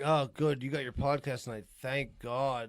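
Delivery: a hint of a wife glad he's leaving; lightly voiced wife. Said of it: "she's like".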